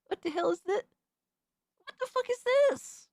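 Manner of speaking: real horrified reaction to the video; shocked disbelief. Real, not acted. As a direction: stretch it out in stunned horror. Shocked by a cursed clip.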